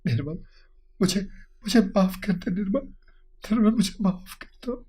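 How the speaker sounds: soft, high, weeping plea; tearful begging. A narrator's reading. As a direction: beg forgiveness through tears. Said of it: "روتے ہوئے بولا".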